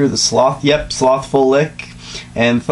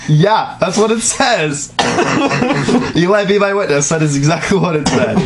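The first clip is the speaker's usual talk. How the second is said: laughing; 5 semitones higher than usual. Real, not acted. Reader laughing high, insisting to the friend it's true. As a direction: giggle it out, insisting.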